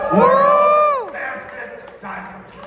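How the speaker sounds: high, slow hype shout; excited shout. Real, not acted. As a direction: yell it like a big reveal. Shouted record reveal.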